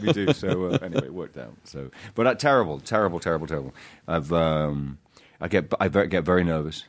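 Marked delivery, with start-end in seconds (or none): none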